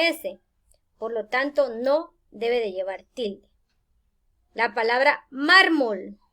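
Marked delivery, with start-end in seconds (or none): 5.3-6.2 "mármol" with MAR slow and punched; stress on first syllable. Shown as a demonstration. Teacher introducing a grave word, stress on first syllable. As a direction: lean hard on MAR in "mármol". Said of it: "lleva el acento o la mayor fuerza de voz".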